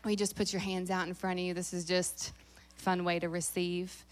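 normal talking voice

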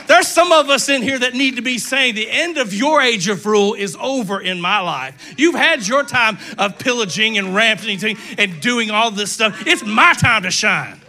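loud, forceful preaching; rally the congregation against the devil; defiant rallying cry. Acted. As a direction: belt it out like a battle declaration.

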